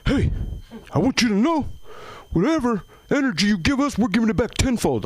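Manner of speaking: high, earnest mock hippie voice; hammed-up spiritual crowd member; preachy audience member. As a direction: imitate a new-age believer. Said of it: "somebody goes".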